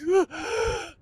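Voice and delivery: sudden desperate inhale; sharp gasp for air. Shown as a demonstration. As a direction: gasp like you forgot to breathe. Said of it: "and you're like".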